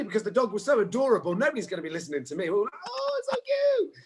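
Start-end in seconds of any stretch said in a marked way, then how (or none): 2.9-3.9 very high, drawn-out mock coo; stretched-out, high-pitched gushing; cooing over a puppy. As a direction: gush sweetly in a long squeal. Acting class cooing over puppy.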